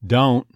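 "Don't" short, O starting on schwa; Texas "Don't". Shown as a demonstration. Coach demonstrates the diphthong, short "Don't". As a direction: neutralize the first half of "Don't". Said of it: "Same thing on don't".

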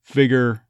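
"Figure" as "FIG-er"; reduced "figure". Shown as a demonstration. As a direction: say "Figure" with no Y sound. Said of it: "to say more figure".

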